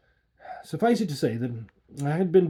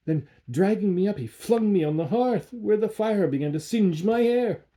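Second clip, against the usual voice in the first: plain reading voice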